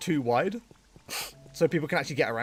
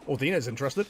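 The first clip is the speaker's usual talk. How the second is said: ordinary talk, nothing marked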